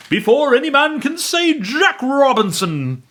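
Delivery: put-on rally the boys cheerfully; jovial Fezziwig. A narrator's reading. Fezziwig calling his apprentices, jovial shout. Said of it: "cried old Fezziwig".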